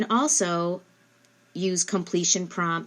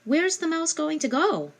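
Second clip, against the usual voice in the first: much higher, lilting question; asking the child; 10 semitones higher than usual. Shown as a demonstration. Parent quizzing child on prepositions, much higher question. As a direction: sing the question up to a small child. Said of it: "ask them".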